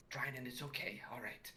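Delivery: very soft, almost whispered; hushed reassurance. Acted. Teacher soothes the impatient pupil after a pause, hushed. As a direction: drop to a near-whisper to calm a pupil. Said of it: "pauses and takes a moment".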